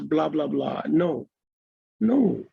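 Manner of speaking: normal talking voice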